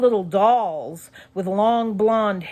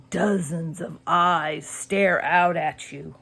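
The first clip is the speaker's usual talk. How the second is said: plain reading voice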